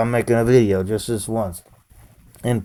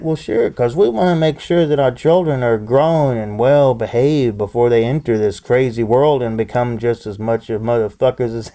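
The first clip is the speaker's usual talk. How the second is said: ordinary talk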